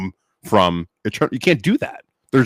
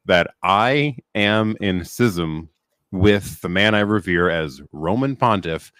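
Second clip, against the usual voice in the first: deliberate, with pauses between phrases; slowly. Shown as a demonstration. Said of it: "I'll say this slowly here".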